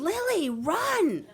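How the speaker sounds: high, drawn-out warning yell; urgent high-pitched holler; retold scream. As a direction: scream it out to someone who has to flee. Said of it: "I scream".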